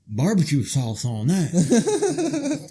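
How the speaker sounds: slow laughing line. Pretend. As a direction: drawl it out through a laugh. Joking, laughing.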